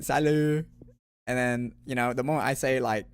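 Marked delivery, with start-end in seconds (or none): 0.0-0.7 put-on loud, higher French-accented hello; French greeting. Greeting the French friend on meeting him, loud French accent. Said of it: "I'd just be like".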